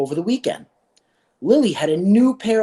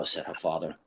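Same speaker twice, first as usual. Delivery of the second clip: plain reading voice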